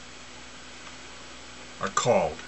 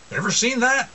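high mocking question; 12 semitones higher than usual. Real, not acted. High mock voice, timbre changed.

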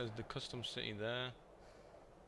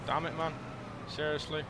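loud, higher frustrated shout; angry outburst; 6 semitones higher, 6 dB louder than usual. Real, not acted. Gamer yelling at the game after a glitch, angry shout. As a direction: blurt it out furious at the game.

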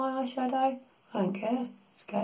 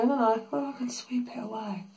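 usual speaking voice

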